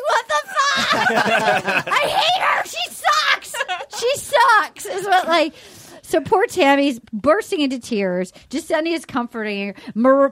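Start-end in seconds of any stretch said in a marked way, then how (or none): 0.1-4.9 high-pitched comic wail of fury; mock jealous outrage. Jealous woman watching her rival kiss, hammed-up outrage. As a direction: whine it furiously, far above usual pitch.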